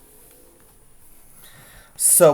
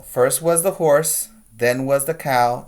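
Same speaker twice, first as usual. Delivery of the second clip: ordinary delivery, nothing marked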